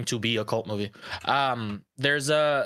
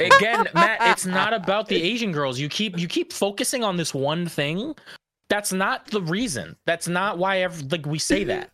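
angry; 6 semitones higher, 3 dB louder than usual. Real, not acted. Heated argument with friend.

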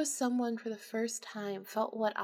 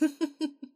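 burst of laughter; 6 semitones higher than usual. Acted. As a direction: crack up uncontrollably. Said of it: "I busted out laughing".

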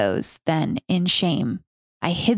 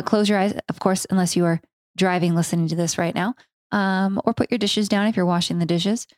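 usual speaking voice

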